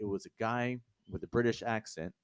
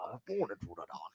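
softer mock of Bane with a Sean Connery-style British accent; 5 dB quieter than usual. Host mocking Bane's quote in a soft Sean Connery-style British accent. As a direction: echo the line as a drunk Tom Hardy doing Sean Connery's British accent. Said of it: "just like fucking Tom Hardy got drunk".

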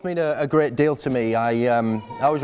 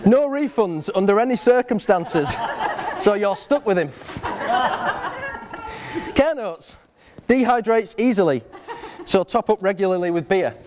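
mock contract reading; 5 semitones higher than usual. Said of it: "It reads".

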